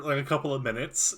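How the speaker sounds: ordinary delivery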